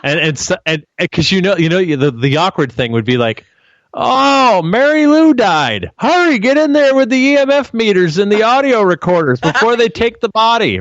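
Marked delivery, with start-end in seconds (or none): 3.9-10.8 put-on high, loud, urgent old-timer voice; excited elderly ghost hunter rushing; frantic old investigator.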